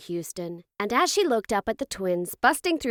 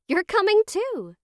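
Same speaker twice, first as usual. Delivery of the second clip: high, lilting reassurance; reassuring mother; 12 semitones higher, 4 dB louder than usual. A narrator's reading. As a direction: tell the worried child brightly. Mother reassures panicked son.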